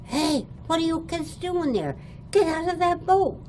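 hammed-up yell chasing kids off his boat; angry hollering. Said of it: "he started hollering".

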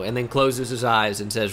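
normal talking voice

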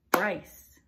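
Bryce in one loud beat; name clapped out. Shown as a demonstration. Teacher clapping out Bryce's name for the class. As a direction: clap it once, loud.

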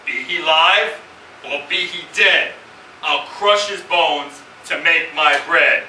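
loud, slow, eerie giant chant. Retold or imitated. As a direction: boom it out like a menacing giant. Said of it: "began in this eerie voice".

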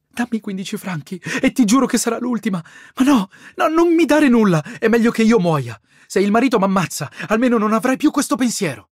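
weep and rage at yourself; tearful brother. A narrator's reading.

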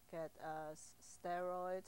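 ordinary delivery

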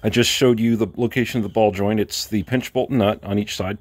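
normal talking voice

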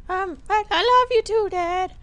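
put-on very high falsetto, hesitant child; squeaky kid voice. Timmy answering dad's I love you, squeaky kid voice. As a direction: squeak it haltingly like a little boy. Voicing Timmy replying to dad.

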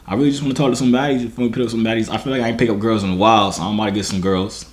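ordinary talk

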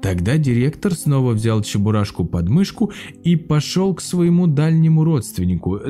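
plain reading voice

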